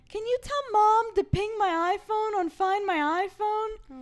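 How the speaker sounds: high, loud, drawn-out plea; hammed-up slow whine down the phone; mock pleading wail. Asking dad to have mom ping it, high mock wail.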